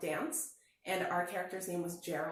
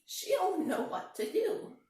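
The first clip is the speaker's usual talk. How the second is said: cheerful sheep; 8 semitones higher, 4 dB louder, slower than usual. A narrator's reading. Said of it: "said the sheep".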